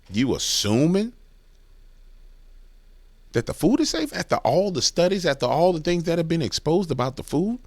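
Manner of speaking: high-pitched, exasperated disbelief; incredulous confrontation. Acted. Challenging a vegan defending this man, incredulous confrontation.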